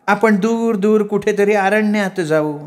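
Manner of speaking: slow, high woman's voice; coaxing sweetheart. A narrator's reading. Woman inviting her darling to the forest, coaxing. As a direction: draw it out dreamily in a high voice.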